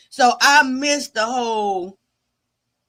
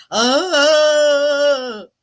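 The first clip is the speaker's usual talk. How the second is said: a loud drawn-out put-on groan; mock groan; 3 semitones higher, 7 dB louder than usual. Streamer jokingly groaning after "woe is me". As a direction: groan it out long and loud, hamming it up. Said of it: "Was that a moan? That was a groan".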